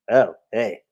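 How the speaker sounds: lightly voiced sleazy Quagmire, laughing through it; a hint of Quagmire grabbing the cloak. Quagmire pulls off the cloak, lightly voiced and chuckling. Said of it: "It's like".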